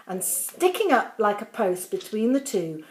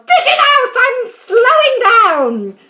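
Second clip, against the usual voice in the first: put-on high, loud screech of an old woman; yelling crone; 14 semitones higher, 12 dB louder than usual. A narrator's reading. Grandma yells at George for more medicine. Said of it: "she yelled".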